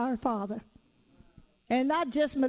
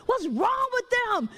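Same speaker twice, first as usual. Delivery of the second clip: fussing; 7 semitones higher, slower than usual. Retold or imitated. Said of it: "My husband was fussing at the people".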